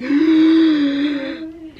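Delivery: long, high wheezing gasp; desperate inhale. Retold or imitated. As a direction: imitate sucking in air after endless laughing. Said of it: "I really needed an inhale".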